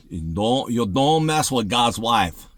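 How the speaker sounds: usual speaking voice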